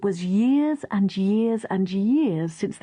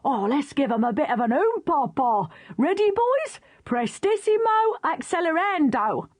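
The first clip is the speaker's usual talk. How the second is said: jolly bandmaster voice; 6 semitones higher than usual. Acted. Bandmaster rallies his band.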